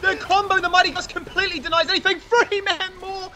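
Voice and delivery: high, fast frantic commentary; calling a wild teamfight; live play-by-play. Real, not acted.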